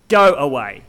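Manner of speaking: loud, low-pitched order to leave; stern dismissal. Shown as a demonstration. Preacher acting out a rejection, stern dismissal. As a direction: repeat the order low and forceful. Acted angry dismissal, repeated lower.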